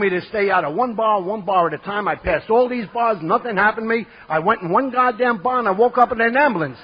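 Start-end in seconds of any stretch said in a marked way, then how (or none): 2.1-6.8 indignant. Real, not acted. Outraged punchline of the story.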